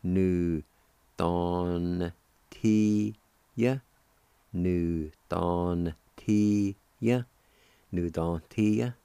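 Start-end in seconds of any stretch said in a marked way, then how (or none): 0.0-3.8 very slow, drawn-out syllables with gaps. Shown as a demonstration. Teacher shows how kindness is pronounced, syllable by syllable. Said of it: "pronounce the N sound with the D A like this". 4.5-7.2 "Nu don ti ya" very slowly, each syllable held; slow sounding-out. Shown as a demonstration. Teacher models the word kindness for learners, slowly syllable by syllable. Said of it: "like this".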